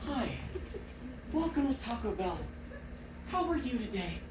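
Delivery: soft, gentle drive-thru welcome; a hint of a sweet automated greeting; sweet recorded greeting. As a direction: coo it like a kind recorded voice. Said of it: "this wonderful woman comes on".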